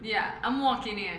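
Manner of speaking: slow Brooklyn tough guy; New York cabbie shouting; Brooklyn accent. Retold or imitated. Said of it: "an old New York accent".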